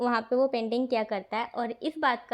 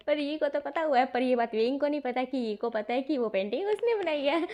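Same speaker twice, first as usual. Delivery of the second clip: ordinary talk, nothing marked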